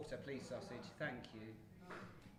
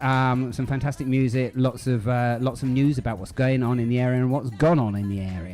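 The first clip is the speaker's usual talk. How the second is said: louder, upbeat show host intro; radio presenter; 24 dB louder than usual. Acted. Presenter welcomes listeners to the live show, upbeat host voice.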